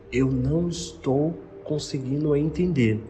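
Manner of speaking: slow, careful, spaced-out words; very slow, deliberate. Retold or imitated. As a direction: spell it out slowly for someone who may not understand. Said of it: "Eu falei bem devagar".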